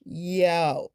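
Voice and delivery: imitating a guy picking up the phone with a lazy yo; casual yo. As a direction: answer the phone like nothing matters, just yo. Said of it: "this is how he picks up the phone".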